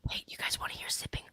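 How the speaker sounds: soft hushed whisper. Real, not acted. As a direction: whisper it under your breath. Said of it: "Why, why are we whispering?".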